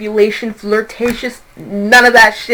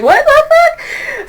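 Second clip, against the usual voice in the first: startled disbelief; much slower than usual. Real, not acted. Reader startled by a flash of light, shocked. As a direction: voice jumps sky-high in shock.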